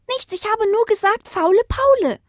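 put-on very high child-like voice; high-pitched little tattler; little puppy. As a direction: protest innocence as a tiny pup. Pup defends himself to father.

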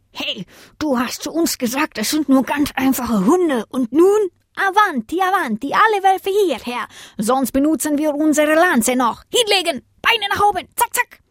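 bossy commands. A narrator's reading. A Saint Bernard orders the beaten wolves down, bossy. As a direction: order prisoners about in a high, excited voice.

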